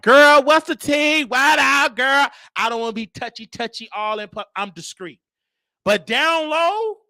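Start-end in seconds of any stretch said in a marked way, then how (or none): 0.0-2.3 loud, slow, high sassy greeting; flamboyant gossip. Retold or imitated. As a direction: call out like the loud friend at the store. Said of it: "I don't like".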